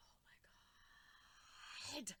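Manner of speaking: slow breathless whispered oh my god; stunned whisper. Retold or imitated. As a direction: whisper it in shock. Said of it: "And I'm like".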